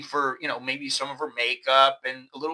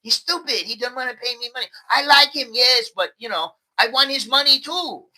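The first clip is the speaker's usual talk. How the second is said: higher-pitched mock complaint in broken English; lightly voiced, pitched-up bargirl grumble; imitating a Thai bargirl; 6 semitones higher than usual. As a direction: whine it like a girl who wants his money. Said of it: "they're going".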